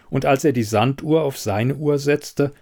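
ordinary delivery, nothing marked

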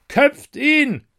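high, loud bloodthirsty cry; crowd shout. Acted. The mob demands the taster's head, shouting. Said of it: "riefen alle in Einigkeit".

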